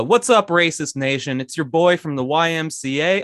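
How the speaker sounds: loud, high-energy promo host; high, punchy mock ad read. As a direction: hype up the listeners for a sponsor. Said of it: "have a short ad read".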